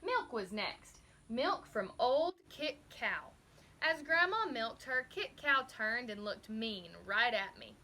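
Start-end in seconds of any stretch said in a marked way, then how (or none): none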